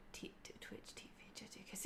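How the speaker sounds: stammering whispered aside; whispered fumbling for the name. Real, not acted. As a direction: breathe it with no voice.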